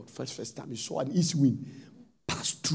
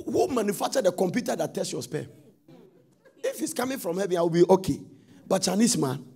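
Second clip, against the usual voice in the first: ordinary delivery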